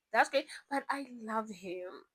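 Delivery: ordinary delivery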